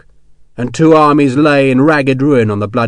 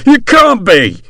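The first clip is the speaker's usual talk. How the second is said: panicked denial; 10 semitones higher, 7 dB louder, slower than usual. Acted. Hexwell panicking.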